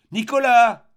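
loud, high, drawn-out shout of a name; crying out. A narrator's reading. Girl calling her missing friend over the steppe, crying out loudly. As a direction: call far across the steppe, voice thrown high. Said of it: "cria la jeune fille".

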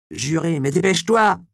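high, loud shout after him. Acted. Magdala shouts to the fleeing Andreas, high and loud. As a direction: yell it after him as he runs. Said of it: "lui cria Magdala".

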